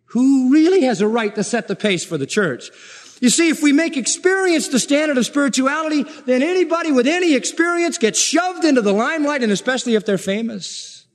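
high, insistent pulpit argument; impassioned preaching. Real, not acted. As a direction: press the indignant case from the pulpit. Preaches at full force.

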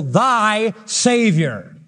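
thy slow and drawn out, Savior after; emphasis on thy. Shown as a demonstration. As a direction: stretch out thy and lean on it. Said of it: "Emphasis on the thy".

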